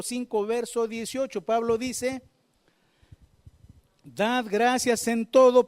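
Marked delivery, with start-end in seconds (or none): 4.0-5.7 proclaimed verse. Acted. Preacher proclaims a Bible verse.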